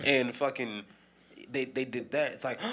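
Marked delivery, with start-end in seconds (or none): none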